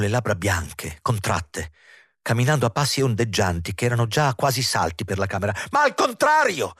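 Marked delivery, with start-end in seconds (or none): 5.7-6.7 very high piercing exclamation; high, sharp protest; shrill cry. A narrator's reading. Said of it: "gridò acuto".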